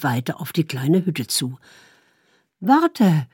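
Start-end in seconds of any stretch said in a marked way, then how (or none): 2.6-3.3 higher, louder Warte called out; wife calling her husband back; calling after him. Acted. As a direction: call Warte after someone walking away. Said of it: "rief sie ihm nach".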